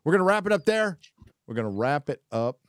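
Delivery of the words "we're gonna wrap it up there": announcer-style call. Acted. Host wrapping up, first take.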